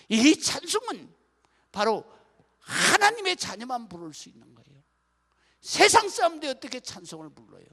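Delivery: loud, high preaching to the congregation; full-force preaching. Acted. Pastor preaching loudly to the congregation, impassioned.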